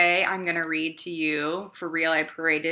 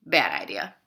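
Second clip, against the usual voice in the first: deadpan verdict; 9 semitones lower than usual. Acted. Deadpan refrain, lower again.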